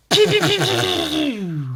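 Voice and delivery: high rapid pew-pew laser sounds; laser noises. Pretend. Streamer faking Death Fist lasers, high pew noises. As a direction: fire off high laser zaps that slide down.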